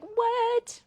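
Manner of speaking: loud, high squeal of surprise; astonished reaction. Retold or imitated. Host recognising Sophie on the live, astonished. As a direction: let the word leap up in disbelief. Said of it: "'Cause I was like".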